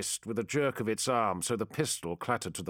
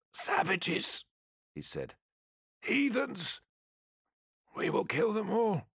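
put-on bitter old soldier; 10 semitones higher than usual. Weakened captive curses aliens.

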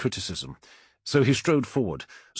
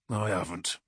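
curt growled denial; 2 semitones lower, 5 dB quieter than usual. A narrator's reading. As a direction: growl it under the breath. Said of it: "growled Forex".

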